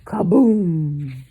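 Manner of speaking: kaboom. A narrator's reading. Lamp crash.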